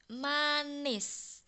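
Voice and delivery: "manis" said with its i close to e, like "manes"; teacher models "manis" with the i leaning toward e; Javanese i read as e. Shown as a demonstration. Teacher showing pupils the Javanese reading of "manis". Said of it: "i-nya itu dibaca seakan-akan e".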